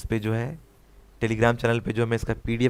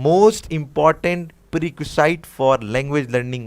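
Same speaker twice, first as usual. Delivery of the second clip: slow dictation; 2 semitones higher, much slower than usual. Shown as a demonstration. Teacher reads exam question slowly.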